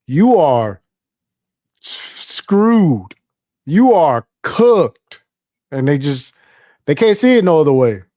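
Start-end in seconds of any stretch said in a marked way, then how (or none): 0.1-5.2 slow, drawn-out pronouncement of doom; emphatic verdict. Acted. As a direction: land each verdict heavily, with pauses. Acted verdict on a hopeless partisan.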